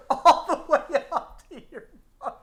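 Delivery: high-pitched, laughing through the words; real giggling, words barely getting out; helpless laughter. Real, not acted. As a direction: squeeze the phrase out while cracking up. Cracks up repeating the phrase.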